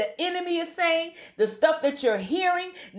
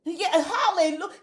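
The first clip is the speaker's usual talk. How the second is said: ordinary delivery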